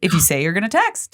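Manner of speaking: ordinary talk, nothing marked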